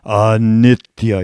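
loud, slow intoned command; Goenka. Retold or imitated. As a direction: intone ANITYA firmly, cutting over the noise. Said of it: "He had a low voice".

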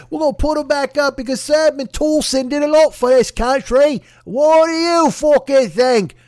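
loud, slow, hammed-up sneering bloke; over-the-top put-on British voice; mock British bloke rant. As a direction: ham it up like a pompous English patriot. Mocking statue rescuers, acted rant.

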